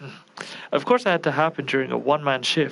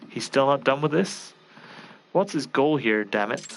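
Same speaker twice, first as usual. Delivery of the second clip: usual speaking voice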